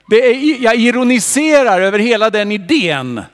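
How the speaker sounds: God rising to thunder down at Job; God challenging Job. Acted. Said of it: "då undrar han".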